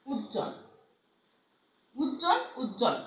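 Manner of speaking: উজ্জ্বল said as ujjol, no b sound; উজ্জ্বল with a doubled j and no b; teacher models উজ্জ্বল as uj-jol; model word. Shown as a demonstration. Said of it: "আমরা কিন্তু এই বটা উচ্চারণ করছি না".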